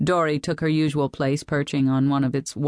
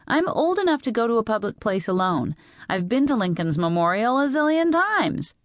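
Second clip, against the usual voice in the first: put-on high, protesting girl's voice; teenage Lee, indignant; 7 semitones higher than usual. A narrator's reading. Teenager arguing to go alone, high indignant protest. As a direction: insist on being old enough.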